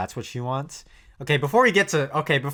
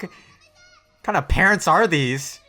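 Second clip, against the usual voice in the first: outraged; 7 semitones higher, 6 dB louder than usual. Real, not acted. Outraged reaction.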